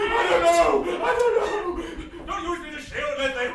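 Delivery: high, loud shout, the second one slow and laughing; Toby blamed for a spooky noise, panicked shout; panicked denial. Acted. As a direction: yelp it out in mock alarm. Toby accused of a strange noise.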